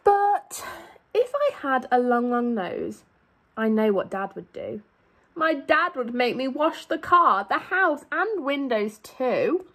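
lively, sing-song storybook read; animated picture-book reading; reading to children. A narrator's reading. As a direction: read it out to a class of little kids.

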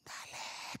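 whispered urging. Retold or imitated. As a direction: hiss it under your breath. Missionary urges him on quietly. (susurrado al cantante)

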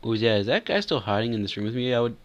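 ordinary talk

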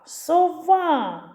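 «Сова» called high, final а held long; calling out. Shown as a demonstration. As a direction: call so-VAAA, stretching а in «Сова». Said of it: "Тут наголошений звук". (кличе протяжно, наголос на «а» в слові «Сова»)

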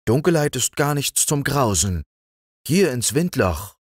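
put-on high, bright child voice; cheerful young girl talking, pitched well up; little girl Liesel. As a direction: speak up like a fearless little girl.

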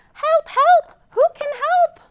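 high, loud little duck crying help; duck calling for help. Acted. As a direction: squeak out a panicky call for help. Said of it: "And the duck says".

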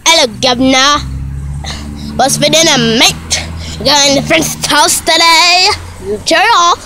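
hammed-up mock-British voice; put-on British accent. Shown as a demonstration. Vlogger redoing the accent demo, mock British. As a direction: do a cheery fake British accent. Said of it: "this about to go like this".

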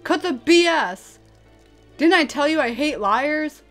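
loud, high-pitched outburst; angry rebuke. Acted. Friend who hates liars scolding her, angry. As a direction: cut them off with an angry scolding.

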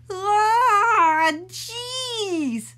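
high loud dismay; shocked exclamation. Acted. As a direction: gasp at the wild hair. Photographer reacts to hair.

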